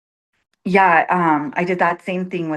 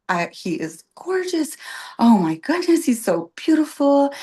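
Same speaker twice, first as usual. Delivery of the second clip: put-on much higher, gushing over the baby; gushing sister; 8 semitones higher than usual. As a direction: coo like a thrilled aunt.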